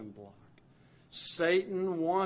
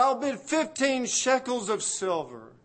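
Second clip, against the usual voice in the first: loud and much higher bid call; 11 semitones higher, 10 dB louder than usual. Acted. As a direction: throw the winning bid over the crowd. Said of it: "Hosea calls out".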